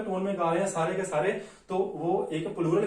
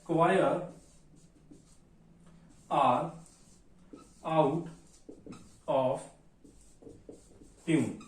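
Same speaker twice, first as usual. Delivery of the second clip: slow dictation; 3 semitones lower, much slower than usual. Shown as a demonstration. Teacher dictates while writing.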